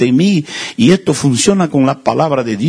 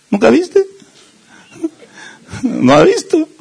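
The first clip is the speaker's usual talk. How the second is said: usual speaking voice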